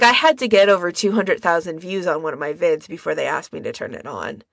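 ordinary delivery

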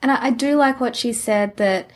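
usual speaking voice

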